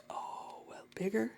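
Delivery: hushed whisper to herself. Real, not acted. Painter whispering to herself over the canvas. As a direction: breathe the words out, barely voiced.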